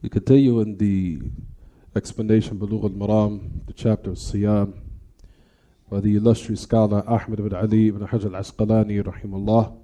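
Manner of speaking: normal talking voice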